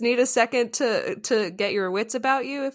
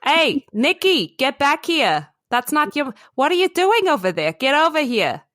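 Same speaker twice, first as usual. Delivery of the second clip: loud, raised New York raccoon parent; New York accent; 7 semitones higher, 5 dB louder than usual. Acted. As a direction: call the kid back in a brash New York accent. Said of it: "The raccoon has a New York accent".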